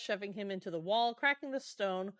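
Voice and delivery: usual speaking voice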